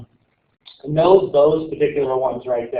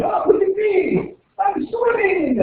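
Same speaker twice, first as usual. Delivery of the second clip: much higher, slower, gleeful shout; silly crab voice; slower than usual. Retold or imitated. Teacher mocking crabs that swim mid-ocean, in a high, slow, gleeful shout. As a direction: squeal it out like a show-off crab swimming. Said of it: "swimming around in the middle of the ocean going".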